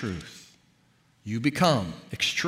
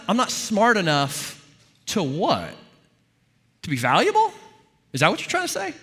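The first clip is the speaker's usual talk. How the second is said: higher-pitched mock questioning of an inner critic; challenging, incredulous; 7 semitones higher than usual. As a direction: egg it on, then query it in disbelief. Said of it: "tell that voice to finish the sentence".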